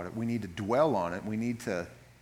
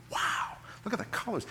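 hushed wow; 8 semitones higher than usual. Retold or imitated. Awed at fall leaves.

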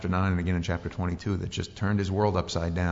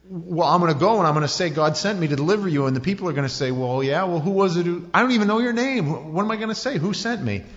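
much higher, louder panicky questioning; hammed-up worried Moses; anxious Moses; 7 semitones higher, 4 dB louder than usual. Moses imagining people's reply.

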